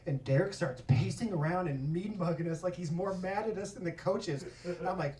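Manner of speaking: ordinary talk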